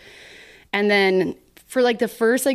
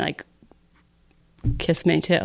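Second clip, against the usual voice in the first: usual speaking voice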